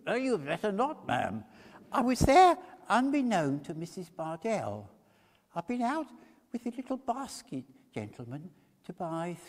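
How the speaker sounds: eager humble witness voice; high fussy old woman witness. A narrator's reading. Mrs Cluppins testifies.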